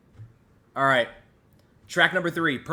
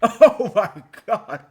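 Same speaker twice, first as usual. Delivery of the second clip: high, loud laughing cry of disbelief; 10 semitones higher, 14 dB louder, slower than usual. Real, not acted. Hearing a ten out of ten track, laughing in awe. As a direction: break into laughter mid phrase. Overcome listening to Thriller track.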